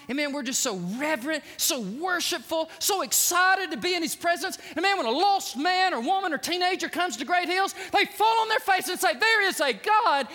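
high, building sermon crescendo; impassioned preaching. Real, not acted. Preaches at full force.

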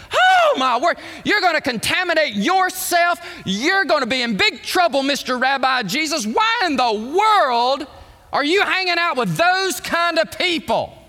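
loud, high-pitched mock horror; hammed-up outraged telling-off; scandalized Pharisee. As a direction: scold with exaggerated religious indignation. Acted Pharisees scolding Jesus.

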